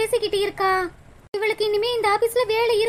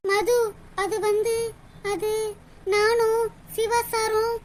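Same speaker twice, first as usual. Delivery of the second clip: shy confession; slower than usual. Acted.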